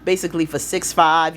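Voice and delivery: ordinary talk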